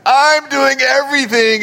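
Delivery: high, put-on aggrieved complaint; mock tattling whine. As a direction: whine like a frustrated sister telling tales. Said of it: "can you just hear it in her voice".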